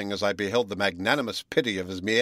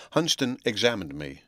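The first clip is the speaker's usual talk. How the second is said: ordinary delivery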